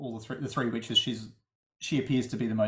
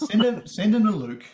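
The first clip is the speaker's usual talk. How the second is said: "send them to Luke" said higher and louder, pushing hard on send; emphatic joke; 8 semitones higher, 8 dB louder than usual. Acted. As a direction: sell the punchline big. Joking about sending complaints.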